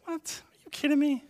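high-pitched mock disbelief; incredulous. Listener hearing the four brothers theory, incredulous. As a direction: react as if it's absurd. Retold incredulous reaction to claim.

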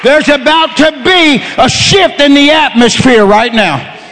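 full-force Pentecostal sermon delivery; fervent preaching. Real, not acted. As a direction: shout the promise out to the whole congregation.

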